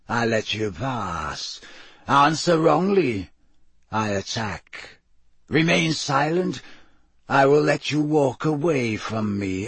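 slow, deliberate creature voice laying down terms; hoarse sphinx. A narrator's reading. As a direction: pronounce each condition slowly, swelling then sinking. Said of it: "in a deep, hoarse voice".

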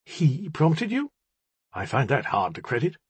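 mildly brittle, incredulous voice. A narrator's reading. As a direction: stiffen into a brittle question. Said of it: "His voice grew brittle".

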